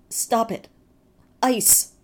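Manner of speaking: loud high cry; delirious boy shouting in panic; cried out. A narrator's reading. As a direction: shout it desperately. Boy crying out in vision.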